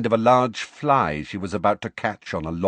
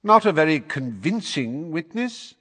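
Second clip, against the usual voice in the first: airy, raised dismissive remark; lofty; 8 semitones higher, 3 dB louder than usual. A narrator's reading. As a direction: look down your nose at her. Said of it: "said Fudge loftily".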